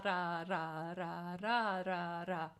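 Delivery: ra ra ra with the tongue tapping; rolled r exercise. Shown as a demonstration. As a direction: repeat ra, trying to roll the r. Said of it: "you can just be working on the ra".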